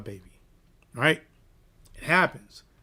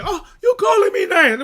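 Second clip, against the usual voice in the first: much higher, loud indignant squawk; mock shout; 10 dB louder than usual. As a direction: shout it back like the offended mother. Quoting the mother's outburst.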